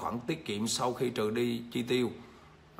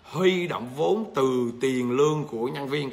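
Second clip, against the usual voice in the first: slow, every word spaced out for note-taking; slow dictation; slower than usual. Shown as a demonstration. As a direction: read it out slowly for the class to copy. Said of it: "Các bạn ghi vô".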